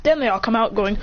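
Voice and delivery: plain reading voice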